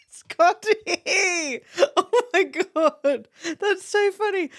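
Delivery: high, drawn-out laughing exclamation; laughing hard. Real, not acted. Streamer cracking up at a mustard mix-up, laughing high. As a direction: crack up through the words.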